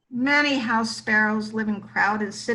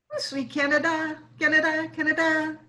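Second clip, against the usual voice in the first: higher, quick sparrow song; sparrow tune; 4 semitones higher, faster than usual. A narrator's reading. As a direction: lilt it like a sparrow on a cold morning. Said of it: "add a warm tune".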